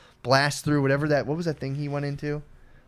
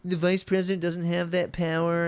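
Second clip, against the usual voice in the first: high, slow mocking drone; imitated nitpicker, pitched up and stretched; mocking pedant; 6 semitones higher than usual. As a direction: drag it out like a tiresome quibbler. Mimicking people quibbling over technicalities.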